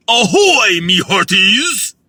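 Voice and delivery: loud pirate "Ahoy, me hearties!", slow at the end; pirate hail. Pretend. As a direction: hail the crew like a pirate captain. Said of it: "that was a little better".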